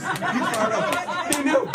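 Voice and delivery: high, loud laughing exclamation; cracking up about the man who knew. Real, not acted. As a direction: laugh it out, delighted. Laughing excitedly about Hodo.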